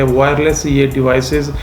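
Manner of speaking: normal talking voice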